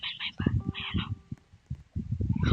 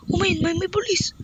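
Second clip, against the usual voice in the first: usual speaking voice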